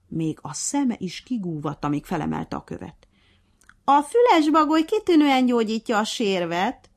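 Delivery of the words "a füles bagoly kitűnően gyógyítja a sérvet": cheeky. A narrator's reading. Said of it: "szemtelenkedett a hangya".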